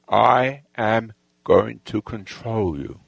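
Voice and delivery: slow, low, deliberate declaration; re-enacted slow, controlled command; measured command. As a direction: stretch each word like a hypnotist's order. Retold commanding face-off with gang leader.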